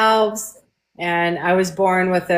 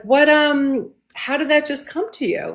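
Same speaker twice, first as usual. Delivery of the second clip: startled question; 7 semitones higher than usual. Retold or imitated. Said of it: "And I was like".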